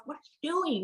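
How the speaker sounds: silently mouthed, barely voiced. Mother to naked husband in front of daughters, soft mouthed what. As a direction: mouth it so the kids can't hear. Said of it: "I kind of mouth".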